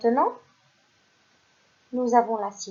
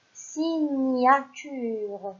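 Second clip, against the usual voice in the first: signature stretched out, syllable by syllable; slow, clear sounding-out; slow reveal; 2 semitones higher, much slower than usual. Shown as a demonstration. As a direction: draw out signature for the children. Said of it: "teacher reveals answer slowly".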